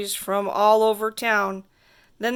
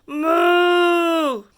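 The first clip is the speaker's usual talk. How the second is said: long, higher, louder moo; cow moo; 5 semitones higher, 4 dB louder than usual. Acted. The cow looks at the kid and moos, loud drawn-out moo. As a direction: moo out like a big cow in the road. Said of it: "and the cow says".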